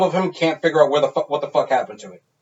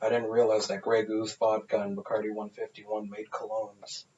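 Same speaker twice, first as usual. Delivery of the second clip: much softer, lower dry murmur in the ear; leaned-over aside; 6 semitones lower, 14 dB quieter than usual. Retold or imitated. Friend's dad leans over at graduation, soft dry aside. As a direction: murmur it close, like a dad's dry jab. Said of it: "he just leaned over and goes".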